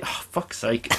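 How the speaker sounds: quiet fed-up aside; retold exasperation. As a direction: curse under your breath, mock-weary.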